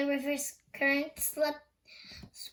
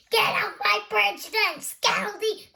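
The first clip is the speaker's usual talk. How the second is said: higher, louder troll shout; 6 semitones higher, 5 dB louder than usual. Acted. Troll orders the middle goat off his bridge, shouting. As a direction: roar the order like an angry troll. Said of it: "So he shouted".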